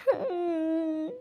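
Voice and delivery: slow, wavering crying hum; acted sob. Daughter asking her mother why she married her off, sobbing hum. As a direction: let a drawn-out sob escape.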